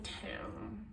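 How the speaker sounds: breathy whisper of disappointment; whispered damn. Retold or imitated. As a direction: let it slip out under your breath. Reacting after the price news.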